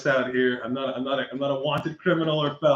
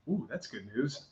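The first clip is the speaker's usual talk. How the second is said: teasing aside, much softer; joking; 13 dB quieter than usual. Retold or imitated. Driver to officer about suspended license, quiet teasing. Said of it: "I was joking. I was like, teasing".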